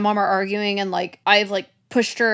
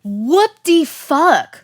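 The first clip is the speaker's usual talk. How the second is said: loud, drawn-out, higher-pitched shock; real disbelief; 6 semitones higher, 5 dB louder, much slower than usual. Real, not acted. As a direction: slowly stretch each word in appalled disbelief. Listener reacts to shocking reason.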